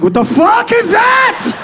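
very high, drawn-out outraged yell; hammed-up screech of bewilderment; incredulous shout. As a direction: shout it slow in disbelief. Outraged reaction to her answer.

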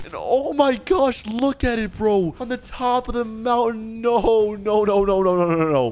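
dismayed shock. Acted. Gamer first sees the mountaintop prison, dismayed shock. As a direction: wail in mock horror, tumbling into repeated noes.

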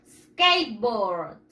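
SKATEBOARD as two slow English syllables, "skeit-bord", falling low; English pronunciation model. Shown as a demonstration. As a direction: model SKATEBOARD for repeating, drawn out and dropping in pitch. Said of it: "Tenemos que practicar".

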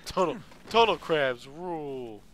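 goofy slogan voice. Pretend. Echoing the slogan in a voice.

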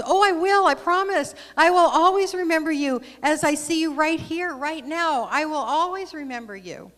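eager promise. Acted. Little soul promising the friendly soul, eager and loud. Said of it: "the little soul promised".

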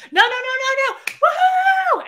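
high, loud frantic shouting into a whoop; mock-panicked rapid noes, then a screamed woohoo; freaking out. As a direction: imitate people frantically shouting someone down. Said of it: "like, freaking the fuck out".

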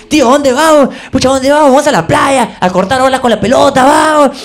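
boy thrilled to train, hammed-up; excited kid. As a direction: bounce with excitement at the uncle.

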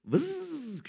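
high, soft imitated buzz; buzzing noise. Pastor joking about a sunken boat, imitated buzz. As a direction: make a quick buzzing sound effect.

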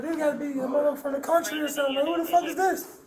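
high, fast, incredulous outburst; stunned disbelief. Retold or imitated. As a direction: blurt it out in shocked disbelief. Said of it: "I'm like".

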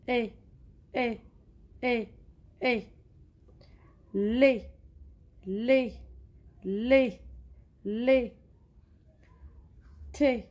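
steady chanted letter sounds; teacher leading pupils in a sound-out drill. Shown as a demonstration. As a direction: sound out each letter for the class to repeat. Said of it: "نعاودو نديرو لا ليكتور".

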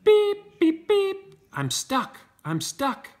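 very high loud beeps, then a pleading I'm stuck; squeaky beeps and plea. Acted. Little truck stuck in mud, high beeps then a plea. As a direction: beep in panic, then plead.

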